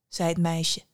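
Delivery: usual speaking voice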